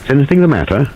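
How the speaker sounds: a sharp, pointed question; sharply. A narrator's reading. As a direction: snap the question out. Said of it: "he added sharply".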